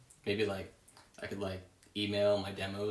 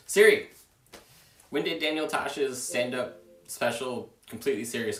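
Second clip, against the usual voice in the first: loud call; 3 semitones higher, 7 dB louder than usual. Real, not acted. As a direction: shout the name across the room. Calling the voice assistant loudly.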